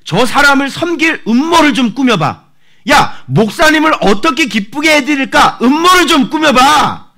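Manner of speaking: high, loud, sly plotting voice; scheming villain voice. Acted. A wicked man urging his friend to plot, sly and loud.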